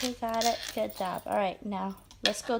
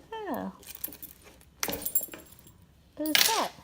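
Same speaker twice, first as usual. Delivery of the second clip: put-on talking to a toddler; 4 semitones higher, slower than usual. Revealing toy figure to toddler.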